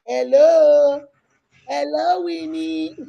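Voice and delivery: high, drawn-out hello; calling out. Real, not acted. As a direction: call down the line for an unresponsive guest. Calling a guest who doesn't answer.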